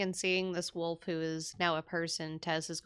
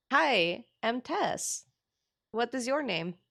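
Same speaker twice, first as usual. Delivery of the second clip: high, bubbly introduction; eager greeting; 7 semitones higher, 4 dB louder than usual. Acted. Tess holding out a gloved hand to a stranger, eager greeting.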